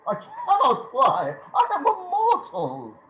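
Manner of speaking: high, laughing protest; nervous laugh. Acted. Scrooge laughing at the ghost's offer. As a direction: laugh it off nervously, pitch climbing.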